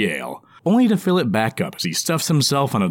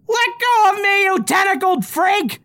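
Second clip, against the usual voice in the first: high, loud, panicked goblin yell; desperate goblin shout; 4 dB louder than usual. Acted. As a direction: scream it as a trapped goblin. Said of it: "Spritz shouts in desperation".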